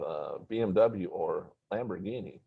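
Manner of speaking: ordinary delivery, nothing marked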